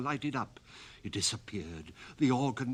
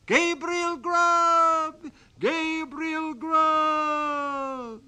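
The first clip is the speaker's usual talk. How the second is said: very high, loud, drawn-out ghostly chant; wild chorus of voices; 11 dB louder, much slower than usual. Acted. Goblin chorus calling the sexton's name, high and drawn out. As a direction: wail his name like a goblin choir.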